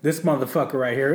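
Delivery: ordinary talk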